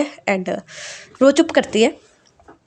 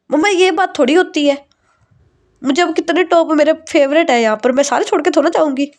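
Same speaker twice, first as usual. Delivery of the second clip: higher, pleading complaint to mom; whiny protest; 6 semitones higher, 3 dB louder than usual. Acted. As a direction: whine like a spoiled daughter. Daughter protests leaving her tops.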